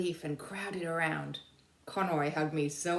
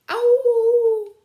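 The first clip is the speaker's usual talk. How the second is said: high loud howling dog cry; 17 dB louder than usual. Acted. Stubby howls to wake the soldiers, loud and high. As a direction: throw back your head and howl.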